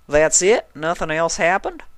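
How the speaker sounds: lightly voiced flirting country mare; flirty Applejack. A narrator's reading. Applejack flirting with the stallion, light country voice. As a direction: sweet-talk the handsome stranger.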